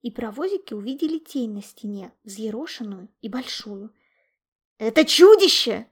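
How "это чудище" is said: very loud, high-pitched yell; full-voiced cry of fright; panicked shout. A narrator's reading. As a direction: scream the alarm to everyone. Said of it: "закричал Гром".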